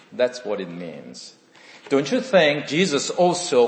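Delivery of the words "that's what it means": normal talking voice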